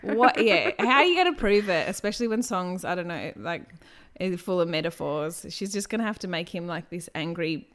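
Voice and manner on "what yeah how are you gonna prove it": exasperated. Real, not acted. Exasperated rant about proving it.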